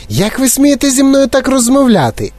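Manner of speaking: indignant lady taking offence; outraged. A narrator's reading. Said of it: "обурилася пані Солт". (обурений вереск, значно вище)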